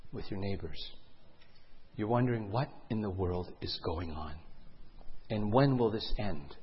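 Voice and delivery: low, very soft narrator voice; hushed suspenseful storytelling. Acted. Storyteller puts listeners among scared neighbors, low and hushed. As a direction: draw them into the worried wait.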